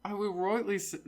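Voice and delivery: hesitant try at a British accent. Shown as a demonstration. Reluctant podcaster showing a cohost a British accent. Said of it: "show us your British accent".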